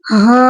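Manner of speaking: "ہا" said thick and full-mouthed; a full, heavy "ہا" from the throat; "ہا" with a full-mouthed throat sound. Shown as a demonstration. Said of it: "یعنی موٹا پڑھیں گے".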